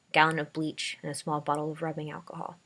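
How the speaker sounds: plain reading voice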